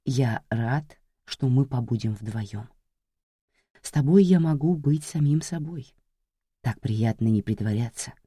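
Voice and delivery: тихо, низко, вкрадчиво; ласково-жуткий голос убийцы; зловещий шёпот маньяка. Acted. Маньяк говорит с жертвой. (тихий вкрадчивый голос маньяка)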